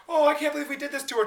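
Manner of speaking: high, fast mock lament; mock remorse. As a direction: rush out a hammed-up regret. Acting the parents' remorse.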